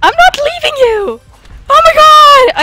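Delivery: loud, very high outburst; panicked protest. Real, not acted. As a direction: cry out in frantic protest. Panicked denial during fight.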